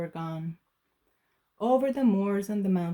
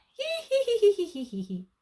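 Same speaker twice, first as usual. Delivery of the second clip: high cackling witch laugh; gleeful high witch cackle; 12 semitones higher, faster than usual. Acted. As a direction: cackle gleefully through the wind. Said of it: "The witch laughed out loud".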